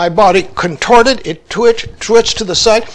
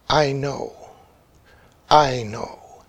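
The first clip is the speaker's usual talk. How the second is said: soft, hushed, loving reassurance; tender whisper; 7 semitones lower, 8 dB quieter, slower than usual. Retold or imitated. Teacher comforting his shaken student, hushed whisper. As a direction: breathe it gently, full of love. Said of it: "so filled with love and protection".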